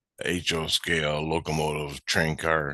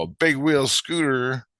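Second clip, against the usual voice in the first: slow, higher retold question; questioning echo; 7 semitones higher, slower than usual. Train maker repeating kid's wish, slow questioning echo. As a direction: echo it slowly, pitched up in doubt. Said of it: "And I go".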